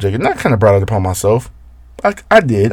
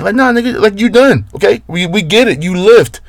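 exasperated rant; 8 semitones higher than usual. Acted. Fed-up rant at the sneezer.

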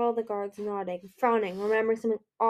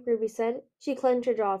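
ordinary delivery

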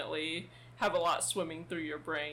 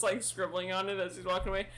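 ordinary talk

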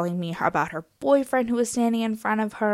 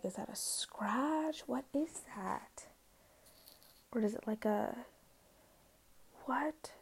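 whisper; 3 semitones higher, 16 dB quieter, slower than usual. Real, not acted. Staring at a scratch on leg.

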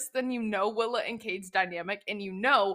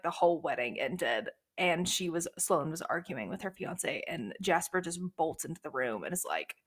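usual speaking voice

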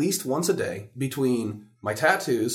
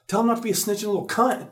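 laughing; 11 semitones higher, 7 dB louder than usual. Retold or imitated.

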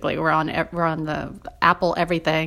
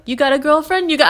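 higher, louder pushy street-guy voice; mock tough guy; 7 semitones higher, 6 dB louder, faster than usual. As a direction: lean on him like a Jersey tough. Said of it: "he's like".

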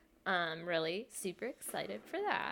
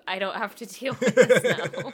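louder mocking imitation; mocking quote; 10 dB louder than usual. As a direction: throw his words back at him. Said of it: "followed it up with".

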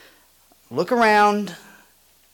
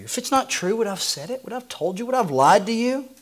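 higher pleading rhetorical questions; earnest pleading questions; 6 semitones higher, faster than usual. Real, not acted. Pastor pressing congregation that Jesus doesn't lie, pleading higher. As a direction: press them, would I lie.

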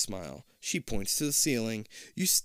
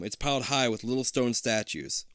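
usual speaking voice

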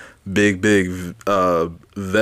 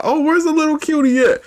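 cutesy high voice. Retold or imitated. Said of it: "I'm like".

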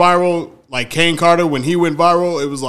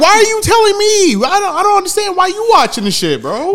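pitched way up, baffled and disgusted; incredulous high mock voice. Friend mocking himself at school, squeaky disbelief. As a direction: play the friend who can't believe it. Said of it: "that's what I'd be like".

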